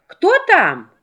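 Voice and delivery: put-on high, small child's voice; kid goat voice. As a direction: call out like a little goat. Kids answer the knock.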